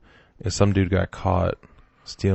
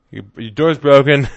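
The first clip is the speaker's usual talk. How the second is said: raised, loud acted alarm; mock alarm; 5 semitones higher, 10 dB louder than usual. Playing the mother finding her door broken, mock alarm. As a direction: blurt it out in mock dismay.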